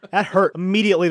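ordinary talk, nothing marked

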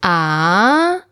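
"ah" long with an upward glide; rising tone. Shown as a demonstration. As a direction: lift "ah" like a question. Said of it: "the rising tone".

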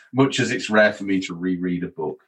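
ordinary delivery

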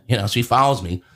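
ordinary delivery

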